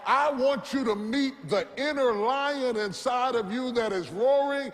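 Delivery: rising sermon climax, shouting over the music; fired-up revival preaching; preaching at full force. Acted. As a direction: preach it with everything you have.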